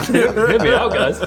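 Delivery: slow, laughing hear me out; drawn out through laughter; amused laugh. Real, not acted. Laughing echo of catchphrase.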